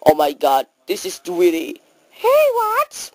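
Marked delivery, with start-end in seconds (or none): 2.1-3.1 high squeaky mouse. Acted. Mouse character reacting to a friend, high mouse voice. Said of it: "no more mouse voice".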